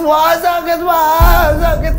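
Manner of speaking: cries of pain. A narrator's reading. Wife with nail in foot, high pained wails. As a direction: wail from the wound.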